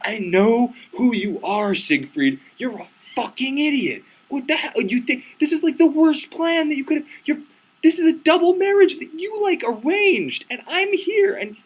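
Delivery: high-pitched hammed-up tirade; real mad, retold. Bride calling the hero an idiot, squealing mock fury. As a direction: splutter with indignation, climbing into a squeal. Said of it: "And she gets real mad".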